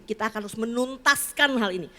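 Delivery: ordinary talk, nothing marked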